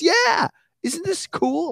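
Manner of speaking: high, slow sing-song wife; mock wife. Wife egging on the prank, high and sing-song. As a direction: tease it sweetly.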